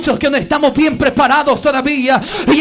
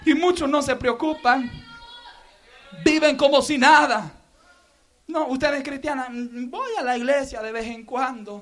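hushed appeal; 7 dB quieter than usual. Real, not acted. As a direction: draw them in with a hush. (súplica en voz baja)

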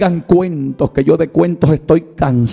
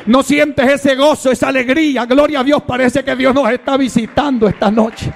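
higher and higher, ending in shouted promises; rousing preaching; 10 semitones higher than usual. Acted. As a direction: lift the church with God's promises. Preaching builds to climax. (predicación exaltada que termina en gritos)

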